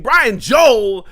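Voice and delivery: loud, high excited thanks; excited shout-out. Real, not acted. Streamer yelling thanks to donor Brian Joel, excited shout. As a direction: yell the donor's name with joy.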